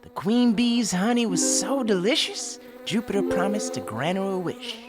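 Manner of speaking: usual speaking voice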